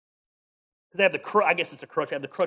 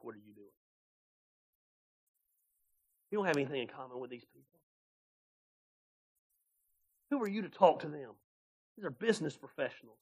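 softer, fast sneering whisper of a tempter; devil's hushed taunt; 4 semitones lower, 9 dB quieter, faster than usual. Retold or imitated. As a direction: murmur the doubts like the devil in his ear.

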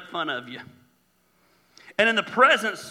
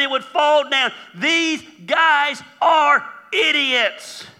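high, loud mocking jeer; scornful heckling, pitched up; ridiculing; 5 semitones higher, 4 dB louder than usual. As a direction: ridicule them, building to a slow, drawn-out final insult. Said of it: "went about the task of ridiculing".

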